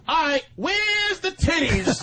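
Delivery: hammed-up shout, slowed; laughing mock shout; mock creepy shout. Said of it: "I'll go".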